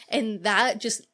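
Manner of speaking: ordinary talk, nothing marked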